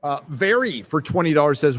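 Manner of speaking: ordinary delivery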